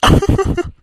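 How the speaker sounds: short breathy laugh, high; husband laughs off his wife's guilt; amused laugh. Acted. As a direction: burst out laughing at her worry. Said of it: "இப்ப எதுக்கு சிரிக்கிறீங்க".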